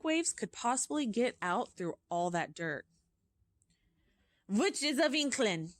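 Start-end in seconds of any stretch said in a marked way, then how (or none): 4.5-5.8 shouted. Acted. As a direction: boom the greeting out to the hall. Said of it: "shouted the Grand High Witch".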